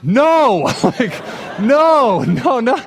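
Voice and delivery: high, loud, panicked cries of no with a laugh; retold panic, shouting high and loud. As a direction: yell no in horror, laughing at the memory. Shuts wife's head in trunk.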